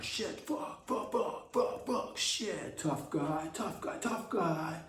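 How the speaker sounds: high, hushed, breathy curse chant; Gavin Newsom impression. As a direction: whisper swear words to himself like a drill. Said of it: "That's my impression of Gavin Newsom".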